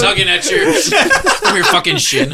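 laughing. Real, not acted. Captain voice while laughing.

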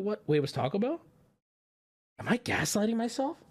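usual speaking voice

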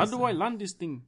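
high, loud put-on Indian accent; mock Indian accent. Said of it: "Still Indian accent".

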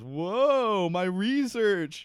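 long, high mock "whoa"; drawn-out comic alarm; startled dismay. Acting startled discovery of research.

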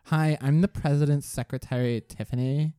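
lightly voiced secretary; receptionist. Secretary introducing herself to a caller, lightly voiced receptionist. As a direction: answer the phone with a hint of a receptionist. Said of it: "she answers the phones".

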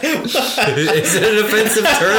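giggling through the words; laughing. Real, not acted. Laughing at own question.

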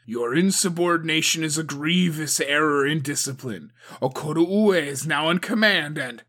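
loud, firm reprimand; stern rebuke. A narrator's reading. Agatoki rebukes Suko for insubordination, stern and loud. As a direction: put her back in line.

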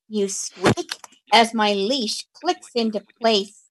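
ordinary talk, nothing marked

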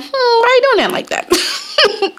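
very high, slow, laughing question; fake-sweet probing. Teasing a coworker about their prep, sugary high voice. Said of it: "I'm like".

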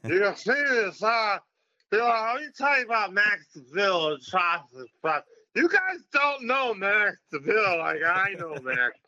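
put-on high, slurred, drunk on-air gushing; drunk self on air. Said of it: "By the time we do the interview, I'm smashed".

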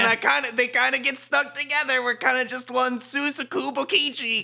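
high, loud, overblown character voice; hammy villain parody. Pretend. Two characters stuck together as one, hammy. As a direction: ham it up as a merged villain.

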